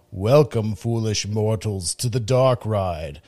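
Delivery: slow, sinister host's welcome; slow theatrical villain menace. Acted. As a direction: welcome the mortals with dark relish. Ghost Host greeting.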